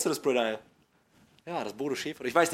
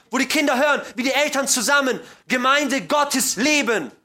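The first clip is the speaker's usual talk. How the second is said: louder, higher, building sermon plea; impassioned preaching; 5 semitones higher, 6 dB louder than usual. Real, not acted. Preacher urges congregation toward holy marriages, impassioned preaching. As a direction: drive it home to the congregation, building in force.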